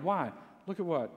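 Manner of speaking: ordinary delivery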